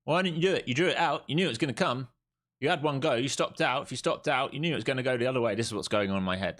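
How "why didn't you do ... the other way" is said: ego talking. Retold or imitated. Trader voicing his ego berating him over a missed trade, higher scolding voice. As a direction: berate yourself like your own harsh ego. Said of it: "That's the ego talking, being like".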